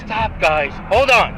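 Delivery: loud, high desperate yell; shouting. Real, not acted. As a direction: yell for them to stop. Drunk driver resisting arrest.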